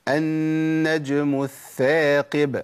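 ترتيل بطيء للثاقب; slow recited demo. Shown as a demonstration. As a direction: recite الثاقب slowly with a clear th. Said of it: "أقول".

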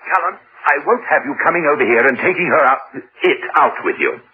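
indignant. Acted. Android's owner objecting by phone to Cullen, indignant.

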